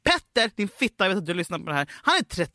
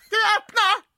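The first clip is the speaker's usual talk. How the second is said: high, loud calling out; imitating a passenger yelling on a packed bus; pushy bus passenger; 10 semitones higher, 3 dB louder, slower than usual. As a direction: call to the driver up front. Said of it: "någon som säger så här".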